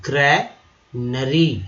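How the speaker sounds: ग्रैनरी said again, slowly and deliberately; slow repeat. Shown as a demonstration. Teacher asking how he said it, slowly repeating it. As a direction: repeat ग्रैनरी slowly so the class can copy it. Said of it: "कैसे मैंने बोला?".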